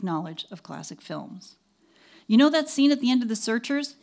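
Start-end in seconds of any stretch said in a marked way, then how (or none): 2.3-4.0 loud, high film-buff question; eager question. A narrator's reading. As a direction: ask it with keen enthusiasm. Director eagerly quizzing the narrator.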